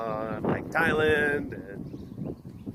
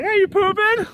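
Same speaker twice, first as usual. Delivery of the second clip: put-on much higher, louder childish taunt; silly kid voice; 7 dB louder than usual. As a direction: squeal it like a giggly kid. Kids' toilet joke acted out.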